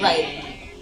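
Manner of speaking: ordinary talk, nothing marked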